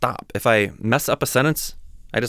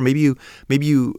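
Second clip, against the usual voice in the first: usual speaking voice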